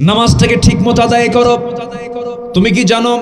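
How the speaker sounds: high, loud exhortation easing down at the end; full-force preaching. Real, not acted. Preacher urging the congregation to pray on time, full-force preaching.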